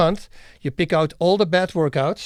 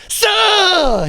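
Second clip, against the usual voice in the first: high, loud strike yell imitating a fighter; mock karate cry; 7 dB louder than usual. Street karate guy showing off his routine, mock battle cry. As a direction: let out a mock karate shout, pitched way up.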